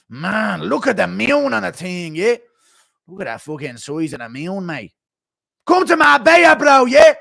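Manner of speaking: an Irish fighter marvelling at the moon; Connor impression. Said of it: "Impression of Connor looking at the moon".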